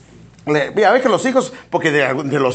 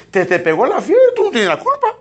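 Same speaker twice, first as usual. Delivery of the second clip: mocking coddler; 8 semitones higher than usual. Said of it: "como dice".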